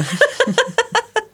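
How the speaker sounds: high delighted giggle; high burst of laughter. Real, not acted. Shared laugh at joke. (éclat de rire aigu)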